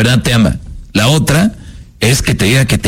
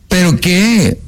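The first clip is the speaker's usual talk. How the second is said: put-on pushy girlfriend; 7 semitones higher, much slower than usual. Girlfriend voice pushes.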